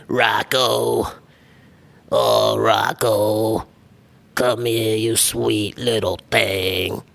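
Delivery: slow, raspy smoker neighbor wife; smoker's voice. Shown as a demonstration. Podcaster voices the toad's wife from Rocko's Modern Life, slow and raspy. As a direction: drag out Rocko's name like a chain-smoking neighbor. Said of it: "his wife had, like, the smoker's voice".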